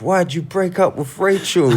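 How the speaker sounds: high-pitched mock yell; retold yelling. Friend heckling a soap actor about his character, mock yelling. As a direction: imitate a heckler hollering, pitched right up. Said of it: "Dredd just starts yelling at him".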